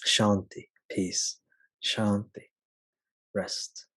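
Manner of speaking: ordinary delivery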